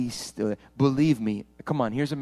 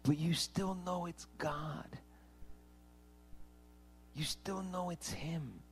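whisper; 4 semitones higher, 10 dB quieter, slower than usual. Real, not acted. Soft aside during sermon.

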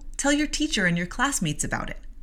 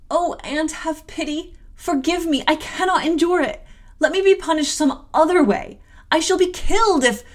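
put-on high-pitched child's frantic plea, building louder; acted terrified pleading; desperate begging; 9 semitones higher, 6 dB louder than usual. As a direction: beg for mercy, getting more frantic. Child begs aunt for mercy.

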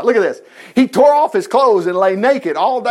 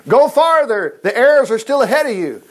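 higher call across distance; 4 semitones higher, 3 dB louder than usual. Acted. Jonathan to the kid fetching arrows, calling out. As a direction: project it out to a boy far off.

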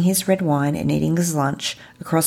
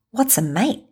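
higher, rising, mildly baffled quote; puzzled question; 6 semitones higher than usual. Retold or imitated. New friend leaning over to ask the traveller, puzzled. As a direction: ask it as if utterly confused. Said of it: "He was utterly confused at this".

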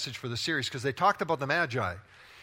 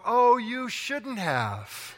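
slow, higher, drawn-out fake thanks with a laugh; fake gratitude; 5 semitones higher, much slower than usual. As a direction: gush insincerely over a gift you don't like. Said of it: "It's all about language, tone, and intonation".

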